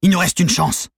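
high, loud desperate insistence; yelled. A narrator's reading. Cornered leader to accomplices, yelled. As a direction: shout it, pitched well up. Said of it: "hurla Valérino".

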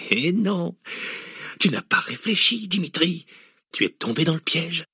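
mad laugh into high-pitched gloating; cackle, then gloat in a high voice; crazed villain crowing over his trap; demented laughter. A narrator's reading. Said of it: "éclata d'un rire dément".

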